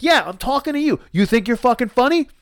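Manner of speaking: high-pitched confrontational challenge; re-enacted indignant yell; angry yelling. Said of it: "I scream at these kids".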